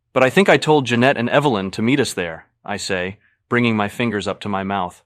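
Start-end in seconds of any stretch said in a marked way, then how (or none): 0.1-2.5 loud, higher, horrified; alarmed at the thought. A narrator's reading. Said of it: "horrified by this possibility".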